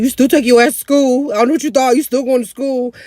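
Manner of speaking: loud, high-pitched scolding; stern mom imitation. Mom ordering pregnant daughter to school, loud scolding. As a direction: lay down the law loudly as mom. Mom laying down the law, acted.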